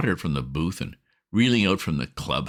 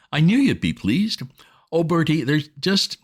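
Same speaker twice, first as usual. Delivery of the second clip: put-on higher, bright-eyed wheedling girl's voice; coaxing young woman; 9 semitones higher, 4 dB louder than usual. A narrator's reading. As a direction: sweet-talk him over the phone, higher and louder. Bobbie Wickham on the phone.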